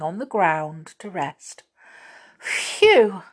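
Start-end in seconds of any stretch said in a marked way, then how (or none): 2.4-3.2 panting after the climb; breathless, gasping words; out of breath. Acted. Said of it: "trying to get her breath".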